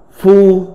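"phu" read as Mandarin fū, level tone; phát âm tiếng Trung. Shown as a demonstration. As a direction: say "phu" as Chinese fū, held level. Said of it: "Phiên âm tiếng Trung đọc là". ("phu" đọc kiểu tiếng Trung, thanh ngang)

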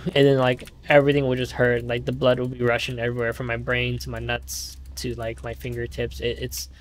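normal talking voice

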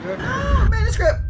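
high, loud cry of alarm; frantic outburst; panicked yell. Real, not acted. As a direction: shout in sudden panic. Panicked yelling at character.

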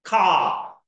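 teacher modelling the long car vowel; long open car. Shown as a demonstration. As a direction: stretch car's ah wide. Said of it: "它是属于大的啊".

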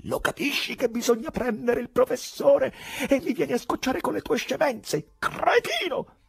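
very high tirade through gritted teeth; rage, climbing to the final insult; seething rage. A narrator's reading. Poet berating the man calming him, furious. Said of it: "digrignando i denti".

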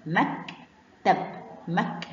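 مكتب split slowly into "mak... tab"; slow, syllable-by-syllable مكتب; sounded out. Shown as a demonstration. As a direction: break مكتب after the k, with a pause. Teacher sounds the word out.

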